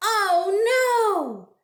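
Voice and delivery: high, loud oh no; mock dismay. A narrator's reading. Reader acting shocked as Pete steps in blueberries. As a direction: cry it out in storybook alarm.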